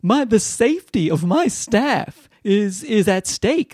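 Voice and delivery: high-pitched imitation of a panicking manager; mock freaking out. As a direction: plead dramatically, mocking her alarm. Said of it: "she starts, like, freaking out".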